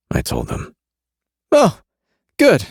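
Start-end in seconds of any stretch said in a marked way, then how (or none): 1.5-2.7 squeaky high voice; high-pitched. A narrator's reading. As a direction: jump way up in pitch. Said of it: "in a high-pitched voice".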